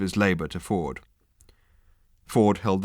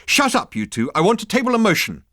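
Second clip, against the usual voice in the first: high, loud strident cry; 12 semitones higher, 6 dB louder than usual. A narrator's reading. Girl silencing Ford and the consultant, strident. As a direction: cut them both off sharply. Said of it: "a girl with a strident voice".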